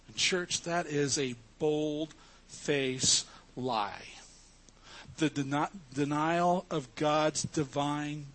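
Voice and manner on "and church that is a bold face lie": normal talking voice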